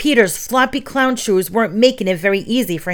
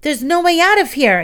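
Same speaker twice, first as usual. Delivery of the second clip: high, louder cry of shock; a boy realising they're trapped, shocked; shocked exclaim; 8 semitones higher, 4 dB louder than usual. A narrator's reading. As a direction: blurt it out in alarm. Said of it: "he exclaimed in shock".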